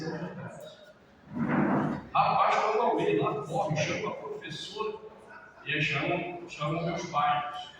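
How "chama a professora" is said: usual speaking voice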